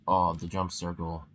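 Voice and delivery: ordinary talk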